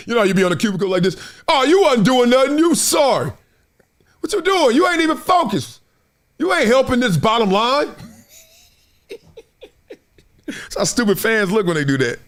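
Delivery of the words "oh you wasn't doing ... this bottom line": mock scolding. Fan scolding an office worker.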